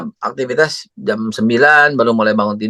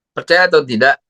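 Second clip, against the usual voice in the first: ordinary talk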